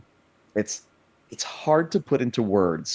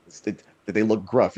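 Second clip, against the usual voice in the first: ordinary talk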